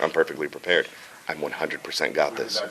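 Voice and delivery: low, soft, sure voice; low cautious hero. Acted. Hero answers are you ready, low and soft. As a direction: keep it down deep and quiet. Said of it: "He's like".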